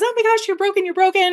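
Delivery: high-pitched panicky cry of alarm; mock panic, voice shooting way up; panicked parent. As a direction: freak out like a frantic parent. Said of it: "And it freaks out".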